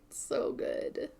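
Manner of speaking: hushed admiring whisper. Real, not acted. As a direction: whisper it like a secret.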